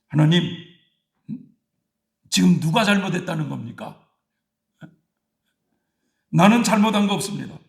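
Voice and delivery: mock-indignant wife talking back; arguing with God. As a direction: argue back at God, offended and defiant. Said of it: "막 따지듯이 얘기를 했다는 거예요".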